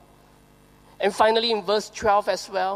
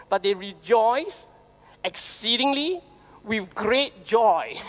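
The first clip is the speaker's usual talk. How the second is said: exceedingly rising, with great joy slowed right down; preacher savoring each word of the verse; stress on exceedingly and great joy; slower than usual. Shown as a demonstration. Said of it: "double emphasis of the word joy".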